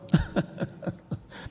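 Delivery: sudden high-pitched burst of laughter. Real, not acted. Pastor laughs at his point to the congregation. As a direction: let a quick high laugh escape.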